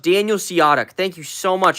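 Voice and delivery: ordinary delivery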